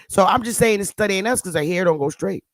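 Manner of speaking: ordinary talk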